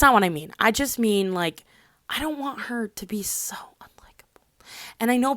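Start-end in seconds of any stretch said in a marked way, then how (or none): 2.1-4.5 soft breathy whisper. Real, not acted. Whispering an aside to her viewers.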